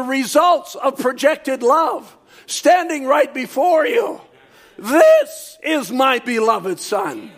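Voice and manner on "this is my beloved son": emphatic THIS in a slow declaration; stress on THIS. Shown as a demonstration. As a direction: stretch out THIS, then let the rest descend. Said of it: "I want to change the emphasis".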